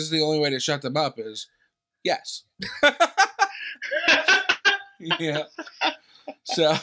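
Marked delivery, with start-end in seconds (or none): 2.6-4.9 cracking up at the church lady; high burst of laughter. Real, not acted. Laughing at own story.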